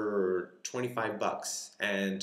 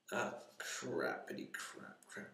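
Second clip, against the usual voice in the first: whispered curse; 2 semitones lower, 11 dB quieter than usual. Real, not acted. Box warped on wet surface.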